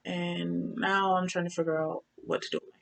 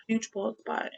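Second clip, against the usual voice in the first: usual speaking voice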